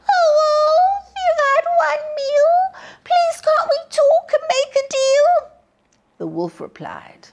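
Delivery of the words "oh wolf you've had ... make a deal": very high, loud squealing plea; pleading piglet. A narrator's reading. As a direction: beg the wolf like a terrified piglet. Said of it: "He cried".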